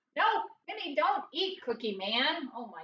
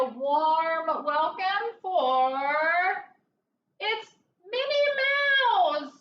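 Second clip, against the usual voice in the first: guest introduction; 2 semitones higher, 5 dB louder, much slower than usual. Acted. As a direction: introduce the star guest with fanfare. Announcing a special guest to children.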